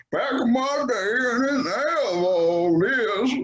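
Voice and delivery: put-on high, slow grumpy old-timer; old man voice. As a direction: grumble it like a cranky grandpa. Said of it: "now I'm sounding like the old guy".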